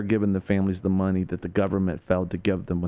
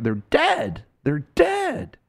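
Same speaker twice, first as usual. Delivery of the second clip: DEAD hit hard, jumping high; impassioned, high stress on DEAD; indignant emphasis; 12 semitones higher, much slower than usual. Real, not acted. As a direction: drive home the word dead in outrage. Indignant over fallen soldiers.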